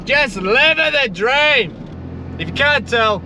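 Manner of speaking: high, stretched-out joyful gushing; ecstatic outburst. Real, not acted. Vlogger gushing to viewers about his day, ecstatic high outburst. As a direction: burst with real joy over the perfect day. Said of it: "sorry for the outburst".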